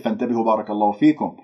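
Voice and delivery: normal talking voice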